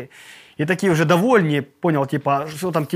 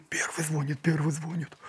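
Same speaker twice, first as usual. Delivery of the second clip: much softer urgent hush; hushed alarm; 10 dB quieter than usual. Retold or imitated. Guards tell each other in a hush that the boss is calling.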